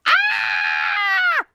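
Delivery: shrill high scream. Acted. Dirty Ulf screaming from her bath, high scream. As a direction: scream out in panic.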